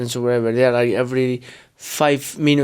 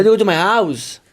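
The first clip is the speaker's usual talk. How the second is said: put-on Gemma's English accent, pitched up; mock English accent; 4 semitones higher than usual. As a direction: try on her English accent. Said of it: "Can you do my accent".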